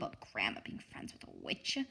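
low, hushed voice under her breath; muttered aside. A narrator's reading. Girl muttering her fear about grandma and the witch, hushed. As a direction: say it to yourself, barely audible.

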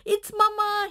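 delighted puppy hearing his mother; happy bark. Acted. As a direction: burst out with joy at Mama's call. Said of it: "he barked happily".

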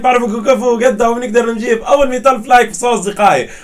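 loud, high, energetic YouTuber opening; hyped channel intro. Acted. YouTuber greeting his fans, asking for likes, loud and hyped. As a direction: pump up the viewers, big and bright.

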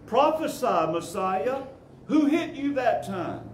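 high mocking taunt, pitched well up; jeering. A narrator's reading. Guards taunting the bound prisoner, jeering high. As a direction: sneer it out like a bully. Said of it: "They jeered".

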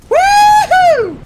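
high, loud triumphant cheer; excited whoop. Real, not acted. Gamer cheering as he escapes in the helicopter. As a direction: whoop as you fly away.

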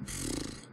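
soft puff of air through the lips; dismissive puff. Real, not acted. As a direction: let out a quiet, resigned pff. Sighing over games nowadays. (leises, abwinkendes Pusten)

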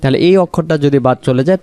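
normal talking voice

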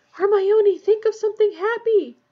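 high, loud desperate shout; urgent yell. A narrator's reading. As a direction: yell to a friend over danger. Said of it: "Harry yelled".

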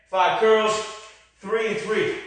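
ordinary talk

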